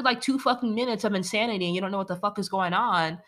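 ordinary talk

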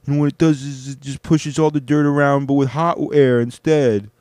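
loud, high put-on brother accent; hammed-up imitation of a brother's accent, pushed high; Yassine. Brother lecturing about cleaning floors, loud exaggerated accent impression. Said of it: "I'm gonna do a Yassine accent".

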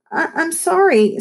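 high, stammering plea; stammered apology. A narrator's reading. Lexi hurrying after her upset friend, stammering. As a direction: stumble over the apology. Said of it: "stammered Lexi".

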